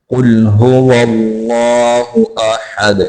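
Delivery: slow, drawn-out tajweed recitation; measured model recital for learners; Quranic recitation. Shown as a demonstration. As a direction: recite the verse slowly, holding the long vowels.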